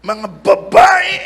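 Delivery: hammed-up accusing yell; mock shout. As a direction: point the finger at the women with a yell. Adam blames the woman.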